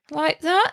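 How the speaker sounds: rising question tune on that; mock uptalk rise; up talk. Shown as a demonstration. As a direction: slide that up like asking. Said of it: "always go up like they're asking a question".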